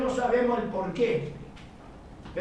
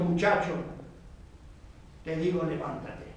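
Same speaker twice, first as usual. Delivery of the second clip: lower, softer solemn command; commanding Jesus; 6 semitones lower, 6 dB quieter than usual. Retold or imitated. Jesus ordering the dead boy to rise, low and solemn. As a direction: speak it low, with quiet authority.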